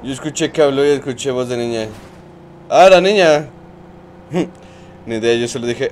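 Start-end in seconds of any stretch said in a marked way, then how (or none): 2.7-3.5 exclamación alta y aguda de sorpresa. Real, not acted. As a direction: suelta el grito al darse cuenta. Reacción sorprendida al chat. (grito de sorpresa en el directo)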